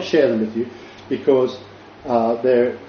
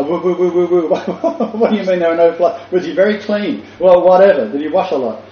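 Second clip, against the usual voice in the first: raised, rushed flustered questioning; higher, hurried, puzzled outsider firing off questions; baffled foreign listeners; 6 semitones higher, 5 dB louder than usual. Acted. Speaker imitates overseas listeners puzzled by the slang, mock-baffled. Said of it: "some people ... are gonna go".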